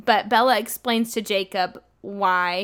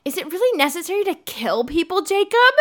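naive question; 6 semitones higher than usual. Retold or imitated. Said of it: "And then she goes".

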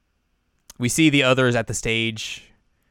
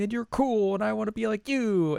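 high, plaintive little voice; put-on helpless pleading; insecure confession; 10 semitones higher than usual. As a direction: pour out self-doubt in a high whine. Acts Chisato's insecure confession.

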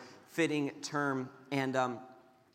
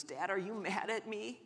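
small, higher, softer pleading voice; imitated tearful child; 5 semitones higher, 6 dB quieter than usual. Crying son asking whether dad is angry, tearful. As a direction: lightly imitate an upset little boy, without real sobbing. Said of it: "he just starts bawling".

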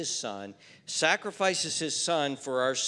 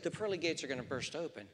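softer, quick, "pearly" lightly stressed; quiet correction; 9 dB quieter, faster than usual. Shown as a demonstration. Nephew correcting his aunt gently, soft and quick. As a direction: say it back softly and plainly. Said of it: "And you're right".

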